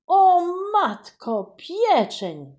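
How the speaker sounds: loud, high, slow alarmed cry; panicked exclamation. Acted. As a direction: cry out in dismay about the roast. Boy realises roast is burning.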